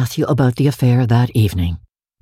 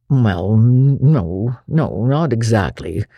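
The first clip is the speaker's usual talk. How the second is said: put-on lower, hesitant older man; uneasy; 3 semitones lower, slower than usual. A narrator's reading. Matthew hedging under Marilla's scolding, uneasy. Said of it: "said Matthew uneasily".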